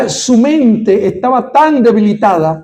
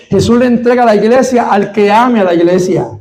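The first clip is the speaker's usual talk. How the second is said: usual speaking voice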